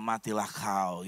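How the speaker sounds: slow, low villainous wish; a mock-evil thought savoured slowly; sinister inner voice. Wife silently wishes death on mother-in-law, slow low menace. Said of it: "Dalam hatinya".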